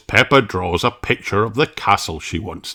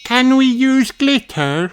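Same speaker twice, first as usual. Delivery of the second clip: put-on much higher, slow child voice; Susie Sheep. Susie asking the teacher about glitter, higher child voice. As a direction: ask eagerly like a small girl. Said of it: "asks Susie Sheep".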